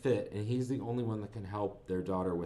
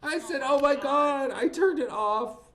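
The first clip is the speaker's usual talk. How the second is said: much higher, talking through real laughter; high, louder words bubbling with laughter; laughing; 6 dB louder than usual. Real, not acted. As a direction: still giggling while telling it. Laughing at the ridiculous scene.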